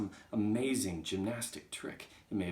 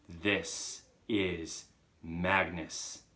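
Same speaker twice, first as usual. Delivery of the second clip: extremely slow, louder, word by word; ominous emphasis; 5 dB louder, much slower than usual. Acted. Chalk repeating its name to Agatha, ponderously emphatic. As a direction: pause heavily after each word.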